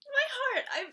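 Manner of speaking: high, loud and laughing; gushing. Real, not acted. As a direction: clutch your heart as you say it. Fan overcome by a sweet scene.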